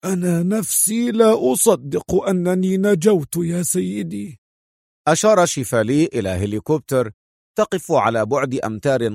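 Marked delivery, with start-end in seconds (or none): 0.1-4.4 higher, strained croak; hoarse, raised character voice; rattling hoarse voice. A narrator's reading. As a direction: croak it like a bandaged injured man. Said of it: "بصوته المتحشرج".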